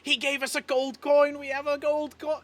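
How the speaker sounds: high, loud giddy cry; thrilled announcement. Acted. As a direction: show off the treasure to the kids. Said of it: "she's like".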